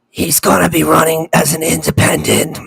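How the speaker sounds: strained, halting politician's voice; Kennedy. Acted. Co-host imitates how the candidate really sounds, strained. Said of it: "I'm not mocking him. That's exactly how he sounds".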